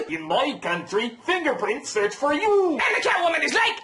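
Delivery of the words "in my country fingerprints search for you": slow, masked villain with Russian comic lilt; Darth Vader-Russian comedian mix. Acted. Bane explaining fingerprints to Catwoman, Vader-Russian mix. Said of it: "sounds like a mix of Darth Vader and every Russian comedian".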